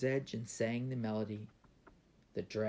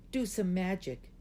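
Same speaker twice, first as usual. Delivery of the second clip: high, mildly raised yell; 9 semitones higher, 4 dB louder than usual. A narrator's reading. Gao calling out to the dragon, high mild yell. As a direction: pitch it up, an excited-child call. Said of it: "Gao yelled".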